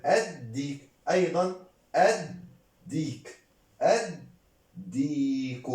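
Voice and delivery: "الديك" split as add, diik, with a doubled d; pronunciation demo. Shown as a demonstration. Teacher models the sun letter, slow split syllables. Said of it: "Prononce الديك".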